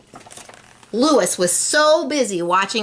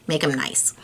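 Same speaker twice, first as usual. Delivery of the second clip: playful whisper with a laugh; 4 semitones lower, 4 dB quieter than usual. Real, not acted. Narrator whispering a tip to young listeners. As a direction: breathe it like a secret.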